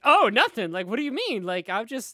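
high, breezy mock innocence; over-bright innocent neighbor act; happy-go-lucky. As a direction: play it loud, high and cheerily oblivious. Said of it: "all happy-go-lucky".

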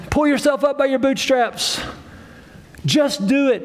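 cheerleading coach. Acted. Pretend coach cheering us on from the other side, high-pitched pep talk. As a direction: urge them on like a little cheerleader. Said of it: "this little cheerleader, this little coach".

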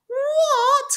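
very high, loud shocked cry; hare crying out. A narrator's reading. The hare hearing the tortoise is finishing, shrieking in shock. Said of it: "cried the hare".

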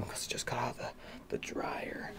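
soft whisper. Real, not acted. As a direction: whisper it to yourself. Whispered aside.

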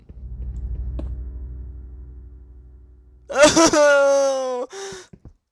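long high shrieking scream into laughter; startled scream-laugh. Real, not acted. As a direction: shriek then burst out laughing. Startled by mob in game.

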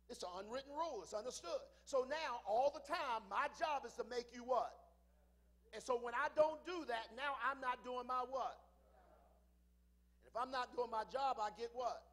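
loud, rising preacher's cadence; preaching at full force. Acted. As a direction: drive it home to the congregation.

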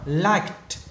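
Liked ending on a crisp k-t; one syllable, Liked with final t. Shown as a demonstration. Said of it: "bên này âm K là khác". (đọc Liked với âm cuối t)

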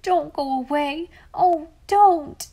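high, loud wailing plea, near tears; blubbering plea. A narrator's reading. Boy sobbing at the girl's feet, begging her not to go, wailing. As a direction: beg her not to leave, voice breaking into a wail. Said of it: "blubbering despairingly".

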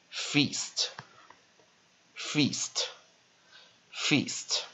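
feast softly, with long "ee" and crisp "st"; slow model pronunciation. Shown as a demonstration. Teacher drilling a new word, slow, careful feast. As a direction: say feast slowly, long pauses between repeats. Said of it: "前面拉成长母音fe".

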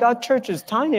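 high-pitched retold disbelief; incredulous. Retold surprise at being recognised.

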